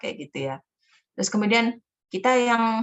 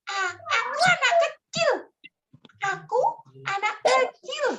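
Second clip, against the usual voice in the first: put-on much higher, small childlike voice; little child voice. Shown as a demonstration. Storyteller demos a child character's voice to teachers, high and small. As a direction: speak like a tiny child. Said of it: "Nah, yang ini".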